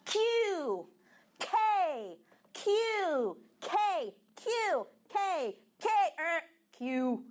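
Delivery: teacher drilling K and Q; letter drill. Shown as a demonstration. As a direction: snap out K and Q for the class. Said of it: "vamos fazer essa mesma prática".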